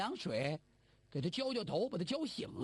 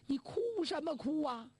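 put-on higher, impatient old mother's scold; anxious scolding mother; 6 semitones higher than usual. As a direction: snap at the weeping son. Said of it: "老太太急了". (老太太着急地责问)

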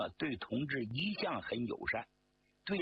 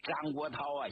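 plain reading voice

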